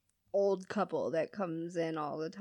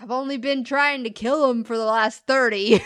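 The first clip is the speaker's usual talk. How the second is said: sarcastic; 3 semitones higher, 9 dB louder than usual. Retold or imitated. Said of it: "All kinds of sarcasm".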